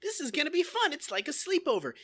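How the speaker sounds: put-on high, oblivious childlike cheer; Gomer Pyle. Clueless recruit arriving at boot camp, mock childish eagerness. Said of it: "he's like".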